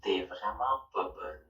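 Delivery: very low, slow filtered voice; deep voice changer. Shown as a demonstration. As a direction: pitch it way down, slow.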